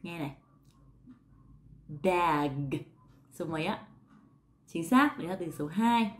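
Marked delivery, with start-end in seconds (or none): 2.0-2.7 "Bag" with a wide open a and a voiced g; "Bag" as /bæg/, open a, final g; quiz bag. Shown as a demonstration. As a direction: say "Bag" clearly for the listener to guess. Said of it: "mọi người nghe xem mình đọc từ thứ mấy".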